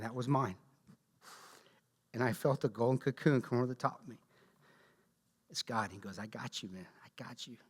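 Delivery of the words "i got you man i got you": much softer, gentle God reassuring; tender reassurance. Pretend. God comforting the Ranger under fire, soft and gentle. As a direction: hush it like a protector calming a soldier.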